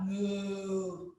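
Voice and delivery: long, low cow moo. Shown as a demonstration. Yoga teacher looking up in cow pose, low mooing. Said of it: "make the moo sound like the cow".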